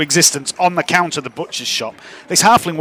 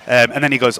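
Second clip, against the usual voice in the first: normal talking voice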